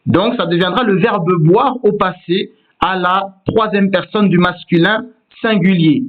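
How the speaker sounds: normal talking voice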